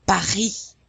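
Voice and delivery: "Paris" as pa-REE, R scraped in the throat, S silent; French "Paris" with a strongly heard R; French wet R. Shown as a demonstration. Teacher demonstrates the wet R, French "Paris" pa-REE. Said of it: "You really hear the R".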